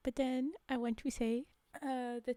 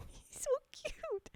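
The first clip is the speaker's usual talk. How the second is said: high, drawn-out coo over something cute; gushing; 12 semitones higher, 3 dB quieter, slower than usual. Real, not acted. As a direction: coo adoringly, much higher. Gushing over a cute monkey photo.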